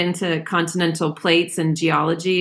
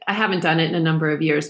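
ordinary talk

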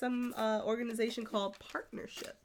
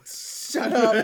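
playful loud; 12 dB louder than usual. Real, not acted. Playful retort to teasing.

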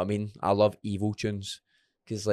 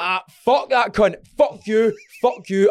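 higher, louder defiant cursing; aggressive inner voice; 9 semitones higher, 4 dB louder, slower than usual. Retold or imitated. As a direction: spit it at an imagined rival. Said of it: "in my head".

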